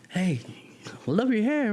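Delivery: high-pitched imitated woman's greeting; friendly compliment. Kathy to a stranger with dreadlocks, high-pitched admiring greeting. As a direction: call out the compliment in a lifted, high voice.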